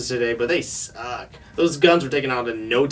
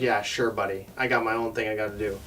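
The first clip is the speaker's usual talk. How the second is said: low, soft, offhand reply; retold brush-off; 7 semitones lower, 9 dB quieter than usual. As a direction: brush him off under your voice.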